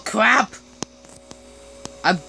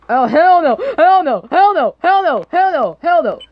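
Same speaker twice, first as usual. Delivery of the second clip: panicked hell no; 6 semitones higher, 4 dB louder than usual. Real, not acted. Scared by something in horror game.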